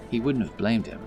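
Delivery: plain reading voice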